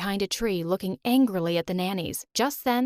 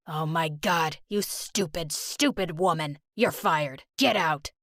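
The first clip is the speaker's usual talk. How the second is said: angry boss; 4 semitones lower than usual. Acted. Boss fires Sally's mother.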